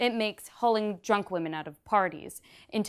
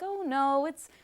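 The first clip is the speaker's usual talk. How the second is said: mock dismissive reassurance; 7 semitones higher than usual. Confronted about that night, they brush it off, mock dismissive. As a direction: wave it away as no big deal, imitating them.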